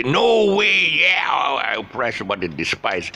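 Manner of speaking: loud, high outburst of mock disbelief; protesting objector. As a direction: exclaim it as a mocking shout, dragging the middle. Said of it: "some people say".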